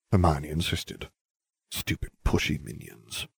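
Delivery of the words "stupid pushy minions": softer, voiceless muttered grumble; muttered aside. A narrator's reading. Harry grumbles privately about his friends, muttering. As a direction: grumble it under your breath.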